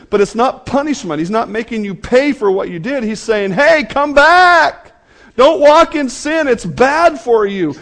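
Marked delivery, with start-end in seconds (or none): 3.5-7.7 high, loud call back from danger; urgent calling out. Acted. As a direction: shout a warning, stretching the opening call. Voices God calling the sinner back.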